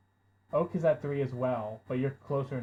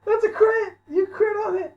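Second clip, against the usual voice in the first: excited shouting; 11 dB louder, slower than usual. Real, not acted. Crit roll, GM shouts in excitement.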